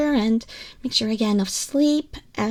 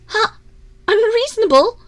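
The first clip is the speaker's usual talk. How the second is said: much higher and louder, astonished; can't believe what she just heard; surprised question; 9 semitones higher, 6 dB louder than usual. Acted. Surprised at listener's reply.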